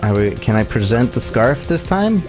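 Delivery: ordinary delivery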